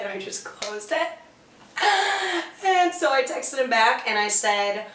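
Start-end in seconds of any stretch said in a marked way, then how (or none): 1.8-2.6 high amused laugh; real laugh at the gross memory. Real, not acted. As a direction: crack up at yourself. Laugh at own story.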